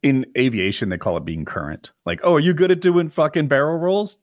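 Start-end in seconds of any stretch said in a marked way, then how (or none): 2.2-4.2 high, louder acted question; mock quizzing. Someone quizzing a pilot on rusty skills, mock quizzing. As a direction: put the question in a raised voice.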